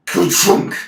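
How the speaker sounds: loud breathy clunk noise; explosive mechanical crunch sound effect; imitated ka-chunk. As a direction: voice the ship slamming to a halt. Imitates the ship stopping.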